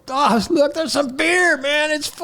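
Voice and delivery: high-pitched excited shout of discovery; excited stranger. Retold or imitated. Somebody going through trash spots free beer, mock excited. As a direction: imitate a thrilled scavenger, voice way up.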